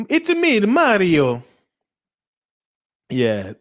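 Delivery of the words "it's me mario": high, loud cartoon Mario voice; hammed-up Italian plumber; Mario impression. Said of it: "Be like".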